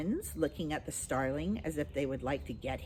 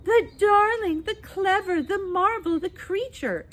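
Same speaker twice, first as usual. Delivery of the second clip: much higher, louder doting aunt gush; gushing Aunt Flossie; 10 dB louder than usual. Retold or imitated. Baby mocks Aunt Flossie cooing over him, high and loud. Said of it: "Aunt Flossie nearly went mad with delight".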